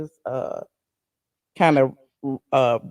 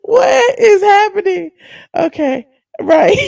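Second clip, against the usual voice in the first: high helpless laughter; cracking up, can't stop; laughing; 13 semitones higher, 8 dB louder, slower than usual. Real, not acted. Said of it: "this whispering has got me".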